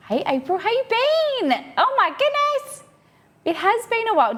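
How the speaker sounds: high-pitched delighted hello; excited greeting. Real, not acted. Delighted greeting of a familiar viewer.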